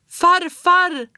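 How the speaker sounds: very high loud relieved cry; joyful scream. A narrator's reading. Child runs to grandfather, high loud relieved shout. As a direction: shout his name as you run to him. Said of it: "skrek jag".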